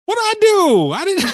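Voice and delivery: high, loud indignant yelp; surprised. Real, not acted. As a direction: squeak out your innocence. Accused, protests innocence.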